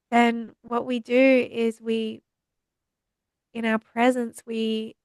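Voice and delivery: normal talking voice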